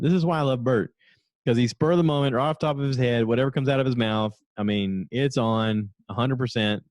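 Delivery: usual speaking voice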